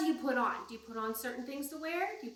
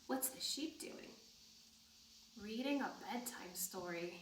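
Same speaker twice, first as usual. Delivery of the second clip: soft hushed voice; 3 semitones lower, 11 dB quieter than usual. Real, not acted. Asks children softly.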